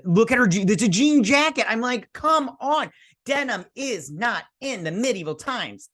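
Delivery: high-pitched, incredulous fashion vent; exasperated rant. Real, not acted. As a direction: protest it in real exasperation, pitched well up. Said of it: "I was venting with my rant".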